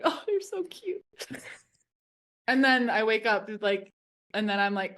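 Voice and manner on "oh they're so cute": high, soft cooing voice. Retold or imitated. Watching kids splash, high soft coo. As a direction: coo like a teary aunt.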